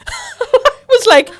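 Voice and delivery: high, loud laughing "I'm what?"; laughing disbelief. Real, not acted. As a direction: crack up in shocked disbelief. Shocked retelling, laughing hard.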